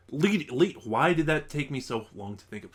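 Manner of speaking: usual speaking voice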